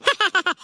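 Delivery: short burst of amused laughing; laughter. Acted. Aunt teased about the baby's choice, laughing. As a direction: laugh in quick ha-ha pulses.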